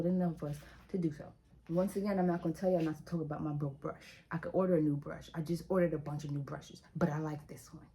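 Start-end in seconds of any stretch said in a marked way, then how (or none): none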